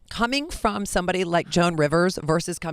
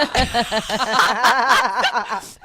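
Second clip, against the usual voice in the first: loud laugh; 6 semitones higher, 6 dB louder than usual. Real, not acted. Laughing at own retold trouble.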